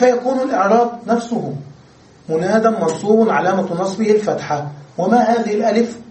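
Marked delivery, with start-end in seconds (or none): none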